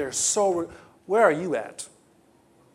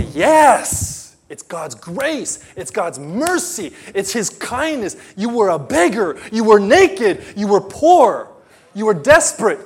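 rising louder, preaching with fire; fervent affirmation; 5 semitones higher, 7 dB louder, slower than usual. Real, not acted. Preacher answering the testimony, rising to fervent. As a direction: build from slow to a loud cry.

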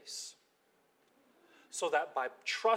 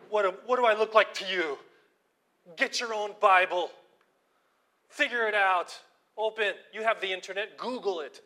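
loud, higher, irritated rant; angry retort; 5 semitones higher, 9 dB louder than usual. Retold or imitated. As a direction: tell the coworker off, fed up.

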